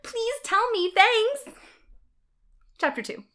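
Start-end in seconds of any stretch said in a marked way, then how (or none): 0.0-1.4 high, loud fangirl pleading; author's note voice. Acted. The author begs readers for praise, high and eager. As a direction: squeal it as an eager amateur author. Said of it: "Author's note".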